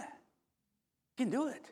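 slow, high, soft urging; stretched-out soft temptation; coaxing inner voice. Acted. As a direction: egg him on in a small, high voice.

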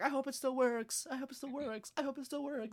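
high, fretful mock pleading; anxious repeating. Boy running with the thing, high anxious mock chant. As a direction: imitate a worried boy hoping against hope.

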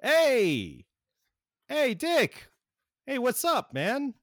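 high, loud buddy greeting; a cheery friend calling out; Wally's voice. Acted. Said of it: "you hear this voice go".

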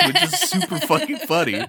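high-pitched laughing through words; cracking up mid-sentence. Real, not acted. Laughing while recounting funny scene.

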